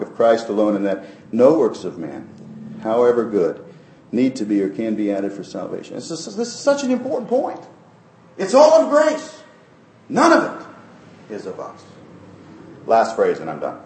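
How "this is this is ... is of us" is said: fervent emphasis. Real, not acted. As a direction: lift it up as the heart of it all.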